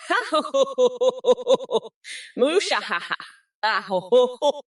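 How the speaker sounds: hammed-up villain cackle, long and rolling; evil laugh. Showing the chat his evil laugh, a hammed-up cackle. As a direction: lay it on thick like a cartoon villain. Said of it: "Here's my evil laugh".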